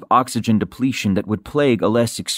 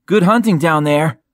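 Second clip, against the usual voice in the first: higher-pitched parting call; 5 semitones higher, 4 dB louder than usual. A narrator's reading. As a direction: call a send-off down to the diver. Tommy calls to diver below.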